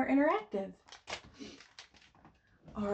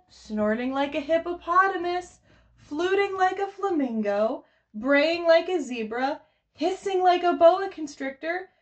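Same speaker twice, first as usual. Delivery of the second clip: higher, lively list reading; reading to children; 5 semitones higher, 4 dB louder than usual. Acted. Teacher reading the final animal list to toddlers, lively. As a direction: rattle off the animals with bounce.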